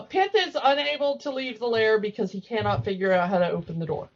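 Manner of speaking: normal talking voice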